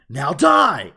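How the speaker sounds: loud mock battle cry; Bakugo. Cocky hero fighting a villain, imitated shout. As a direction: shout it at a foe, hammed-up. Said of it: "he's just very cocky".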